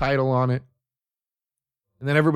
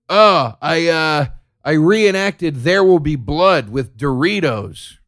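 mock proud YouTuber; 4 semitones higher, 5 dB louder, slower than usual.

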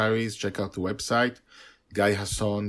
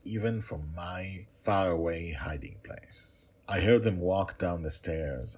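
usual speaking voice

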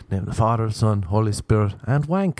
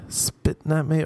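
higher-pitched, questioning repeat; incredulous, pitched-up echo; indignant echo; 7 semitones higher than usual. Acted. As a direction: throw it back in mock disbelief. Indignant echo of his own words.

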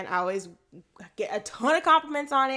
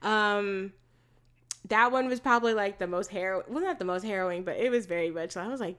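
normal talking voice

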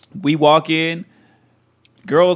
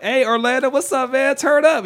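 high, excited party greeting; hyped-up call; 12 semitones higher than usual. Retold or imitated. Greeting Orlando on the dance floor, high and hyped. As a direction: pitch it way up, hyping a friend.

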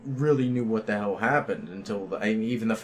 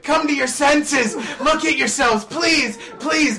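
much higher, louder, slow hammed-up pleading; dead serious plea; 7 dB louder, much slower than usual. Pinned friend pleading with the group, hammed-up. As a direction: beg like a martyr to his captors. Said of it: "the most serious shit".

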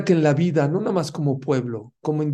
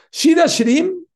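Shir Hashirim cried out high and loud, rising; exclaimed; 9 dB louder than usual. Real, not acted. Preacher to congregation, excited high cry of Shir Hashirim.

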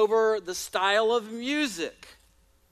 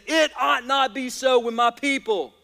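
rally speech; 4 semitones higher, 6 dB louder than usual. Acted. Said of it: "And Jesus is saying".

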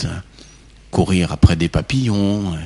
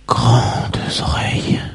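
whisper; 2 semitones higher, 3 dB quieter, much slower than usual. A narrator's reading. Whispered dialogue with the wolf.

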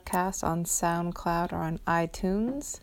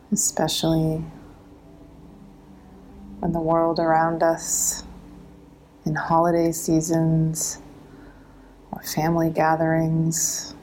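guided meditation; 4 dB louder than usual. Acted.

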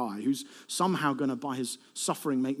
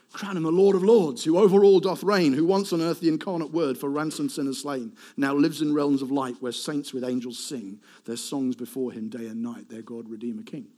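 triumphant hymn recitation; 2 semitones lower, 4 dB louder than usual. Acted. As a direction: proclaim the verse, then let it settle.

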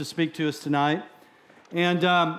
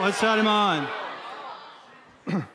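playful shout. Shown as a demonstration. Preacher echoing congregation's question, shouting playfully. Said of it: "I want you to ask me that".

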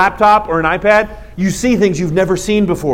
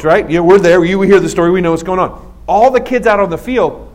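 ordinary delivery

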